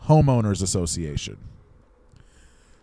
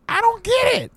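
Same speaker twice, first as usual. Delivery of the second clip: very high-pitched mock-aunt squeal; hammed-up, absurdly high impression; Marissa Tomei; 4 dB louder than usual. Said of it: "My Marissa Tomei impression is really good".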